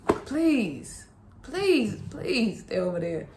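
quiet, drawn-out begging; soft pleading. Real, not acted. Mother softly begging her toddler to stay away. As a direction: plead gently but urgently.

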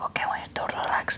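imitated Spanish masseuse whisper; quick, very soft whisper in a Spanish accent; hushed Spanish accent. Spanish masseuse settling a client, hushed accented whisper. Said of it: "like, this beautiful accent".

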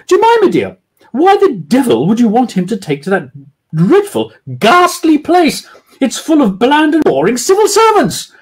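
hammed-up snobbish lady; scornful posh lady. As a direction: sneer at the office. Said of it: "doing women's voices".

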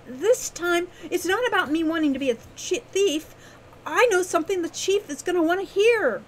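high, loud, insistent voice; eager young Wren. A narrator's reading.

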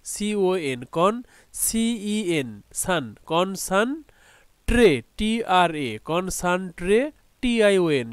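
slow build of "কন-সান-ট্রে", spelling each part; teacher slowly piecing "কনসানট্রে" together; syllable-by-syllable spelling. Shown as a demonstration. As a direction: spell "CEN", then say its sound "সান". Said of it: "এটাকে ভাগ করি".

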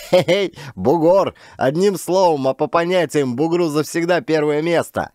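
high, crowing thug voice; rowdy banter. Acted. Gang joking about their boss's first claim, rowdy. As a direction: hail the boss with a cheeky whoop.

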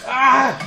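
high, loud yelp; startled yell. Real, not acted. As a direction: yelp out loud mid-fight. Startled yelp while fighting.

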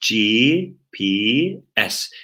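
each letter drawn out and separate; spelling out. Shown as a demonstration. Teacher spells an abbreviation for students, slow separated letters. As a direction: say G, P, S with long gaps.

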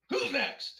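high, loud victorious challenge; triumphant call. Acted. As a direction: challenge the room proudly. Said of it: "Nadia stands up and raises her arms".

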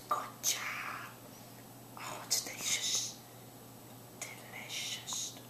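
soft hushed whisper, stretched delicious; whispered praise. Real, not acted. Whispered praise while feeding.

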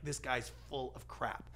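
slow, low-volume skeptical aside; acted dismissive aside. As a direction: play a listener backing away in disbelief. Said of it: "leaning out, going like".

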